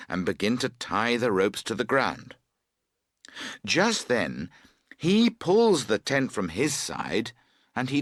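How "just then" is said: ordinary delivery, nothing marked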